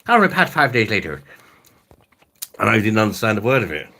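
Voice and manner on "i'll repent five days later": put-on Irish accent. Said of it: "do an Irish accent".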